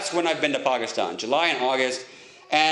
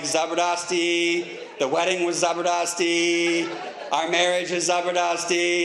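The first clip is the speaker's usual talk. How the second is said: slow proud; slower than usual. Retold or imitated. Proudly misusing Urdu word.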